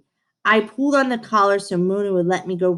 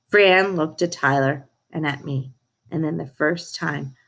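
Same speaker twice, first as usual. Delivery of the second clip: usual speaking voice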